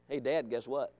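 put-on a boy with a scheme; sly son. Voicing son.

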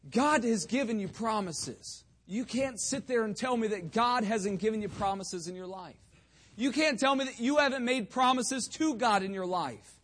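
high, loud, insistent pulpit challenge; emphatic, pressing exhortation; forceful preaching. Real, not acted. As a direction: confront the congregation firmly.

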